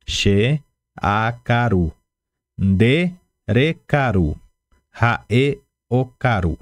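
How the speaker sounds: "she akaru, ndere karu, rae okaru" drawn out slowly; teacher repeating the conjugation for the class to copy; each phrase stretched and clearly separated; slow repetition drill. Shown as a demonstration.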